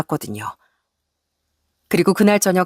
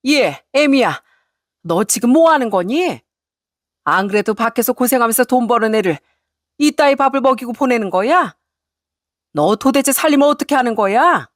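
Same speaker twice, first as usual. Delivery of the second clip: higher-pitched indignant tirade; angry mother-in-law; 4 semitones higher than usual. Acted. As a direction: tear into the daughter-in-law. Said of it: "어찌나 화를 크게 냈는지". (화가 나서 몰아세우는 시어머니)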